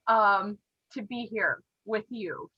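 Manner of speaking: usual speaking voice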